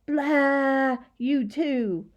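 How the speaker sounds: yelled back. A narrator's reading. Boy sticking his tongue out at a gaboon, yelling back. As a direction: yell the taunt right back. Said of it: "yelled back".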